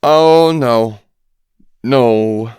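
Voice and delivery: rising nauseated moan of horror; sick moan. A narrator's reading. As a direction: groan it like you're about to be sick. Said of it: "She sounded like she might be sick".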